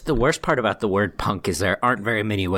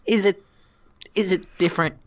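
incredulous; 10 semitones higher than usual. Real, not acted. Mock-incredulous question.

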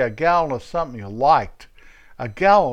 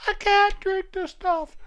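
high falsetto protest; comic high-pitched whimper; whiny patient. Retold or imitated. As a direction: squeak out a hammed-up refusal. Acted quarrel, nurse versus patient.

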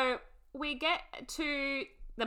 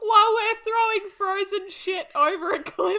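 mock bright confession; 9 semitones higher, 9 dB louder than usual. Voicing Misty's cheerful confession.

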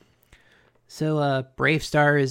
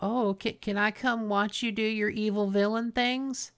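imitated flattering charm; 5 semitones higher, 5 dB quieter than usual. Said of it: "and she's like".

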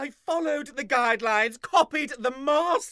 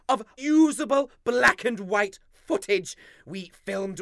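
ordinary delivery

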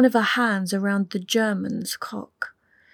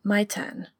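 put-on German accent. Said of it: "A German accent".

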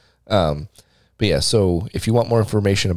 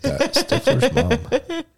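laughing; much slower than usual. Real, not acted. Laughing while naming a pick.

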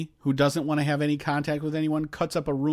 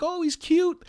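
put-on girly voice; 14 semitones higher, slower than usual. Hot women cooing at the kid.

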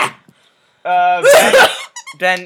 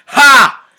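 loud laugh; 8 semitones higher, 13 dB louder than usual. Real, not acted. Laughing at own joke.

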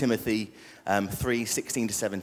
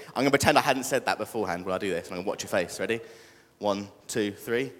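ordinary talk, nothing marked

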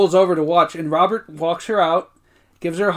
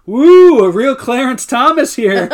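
loud whoop, then slow and higher; imitating Creed; 7 semitones higher, 11 dB louder, slower than usual. Retelling Creed's line, loud whooping mock voice. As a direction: holler it like Creed. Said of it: "Creed going".

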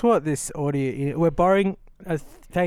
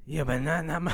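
imitated moaning accent; 5 dB quieter than usual. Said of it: "What is this accent?".

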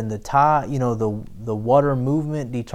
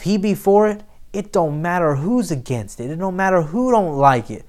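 louder preaching that drives each phrase home; full-force preaching; 5 semitones higher, 3 dB louder than usual. Acted. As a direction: rouse the church and build to a shout.

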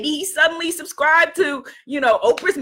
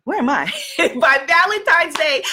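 ordinary delivery

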